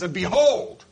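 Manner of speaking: loud, ringing BEHOLD; emphatic proclamation. Acted. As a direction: cut through the doubts with a loud announcement. Jesus's loud reply to doubting apostles.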